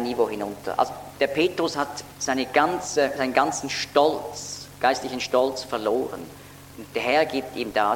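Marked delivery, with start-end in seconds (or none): none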